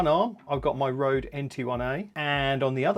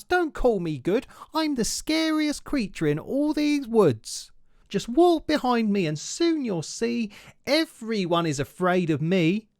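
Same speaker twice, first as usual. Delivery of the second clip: bold little mouse; 6 semitones higher than usual. Acted. Mouse bragging to the Gruffalo, high and grand. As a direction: act huge though you're tiny.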